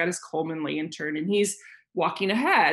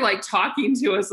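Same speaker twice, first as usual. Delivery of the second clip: usual speaking voice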